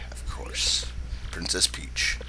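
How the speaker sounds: hushed secretive whisper; whispered character line. Acted. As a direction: lean in and whisper it.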